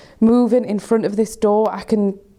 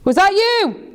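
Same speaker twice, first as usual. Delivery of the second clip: scared calling out; 9 semitones higher than usual. Real, not acted. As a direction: call out in alarm.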